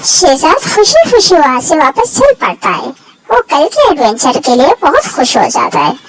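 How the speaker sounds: bright, high storyteller voice; story narration. A narrator's reading. Narrator closes the episode for young listeners, high lively telling. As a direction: tell the happy ending to children.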